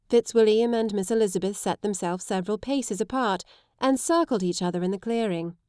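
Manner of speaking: usual speaking voice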